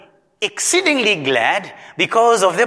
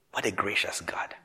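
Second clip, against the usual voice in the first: breathy whispered wonder; soft, hushed amazement at God's grace; awed whisper; 11 semitones lower, 13 dB quieter than usual. Real, not acted. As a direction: whisper it in awe. Awed aside.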